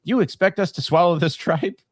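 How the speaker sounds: high-pitched mock indignation; hammed-up sitcom protest; quoted cartoon line. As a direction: pitch it way up as an outraged cartoon character. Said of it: "one of my favorite Simpsons jokes".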